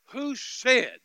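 very loud, high, indignant challenge; angry outburst. Retold or imitated. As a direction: thunder it out in re-enacted outrage. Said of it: "it made me so angry".

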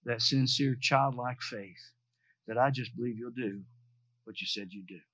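low, hushed, heartfelt petition; earnest prayer. Retold or imitated. Preacher asks God to keep his childlike faith, earnest prayer.

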